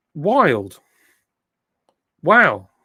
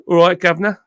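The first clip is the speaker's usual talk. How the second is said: a light southern English "All right, governor" with the r dropped: "guvnuh"; southern English accent; 5 semitones higher, 6 dB louder, faster than usual. Shown as a demonstration. Streamer asked by chat to say governor, southern English accent. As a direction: toss off a cheery southern English greeting. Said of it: "can you say governor again?".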